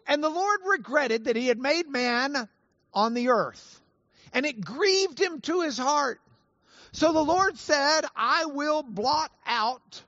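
high, projected Bible reading; scripture reading. Acted. Preacher reads the Genesis flood passage to congregation, proclaiming scripture. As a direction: read it out to the whole room with deliberate weight.

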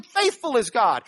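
"faithful" hit loudly, falling to the end; stress on faithful. Shown as a demonstration. Preacher to those whose world is crumbling, emphatic stress on "faithful". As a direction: hammer "faithful" as reassurance. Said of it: "That's his emphasis on this sentence".

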